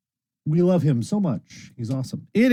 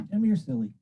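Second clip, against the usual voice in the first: usual speaking voice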